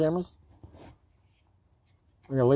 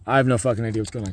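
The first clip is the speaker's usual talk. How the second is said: ordinary talk, nothing marked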